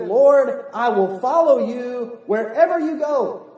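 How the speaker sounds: loud, slow, fervent pledge; slowed, loud vow of a zealous disciple; earnest eager follower. Retold or imitated. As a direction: swear devotion, hammed-up. Eager man pledging to Jesus.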